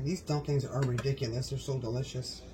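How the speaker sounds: ordinary talk, nothing marked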